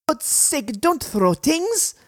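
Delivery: put-on high, protesting child's voice; girl's voice. A narrator's reading. Hidden girl protests at a thrown rock, high girlish voice. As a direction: scold as a girl under attack, drawn out. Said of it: "It was a girl's voice".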